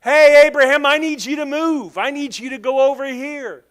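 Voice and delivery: high, loud call to Abraham; God calling out. Retold or imitated. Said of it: "God says one day to him".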